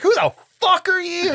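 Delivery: high, loud indignant squawk; mock outraged mother. Mother snapping at Loomis, high hammed-up outrage. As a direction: throw it at him like an insulted mom. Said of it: "And she's like".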